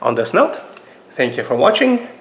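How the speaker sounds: ordinary talk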